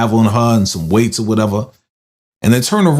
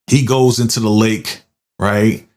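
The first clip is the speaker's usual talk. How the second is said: ordinary talk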